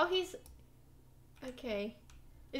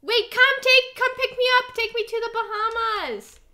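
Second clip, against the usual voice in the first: calling out; 7 semitones higher, 10 dB louder than usual. Real, not acted. Calling for a ride in game.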